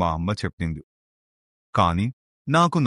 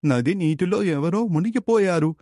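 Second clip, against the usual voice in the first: much higher, urgent calls to the master; urgent calling out; 11 semitones higher than usual. Acted. Disciple calling his guru to the drowning woman, high and urgent. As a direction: shout excitedly pointing at the river.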